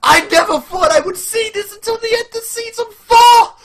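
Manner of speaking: high, loud shout of disbelief; amazed shouting. Real, not acted. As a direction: shout it, still stunned.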